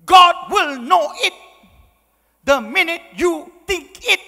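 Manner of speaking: high, loud, slow hammed-up threat; booming mock warning. Mother warning her son after a suspenseful look, booming. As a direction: thunder it out like a divine verdict.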